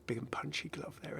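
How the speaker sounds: hushed, soft aside; quiet whispered comment. Real, not acted. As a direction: say it under your breath. Murmured remark on a skin.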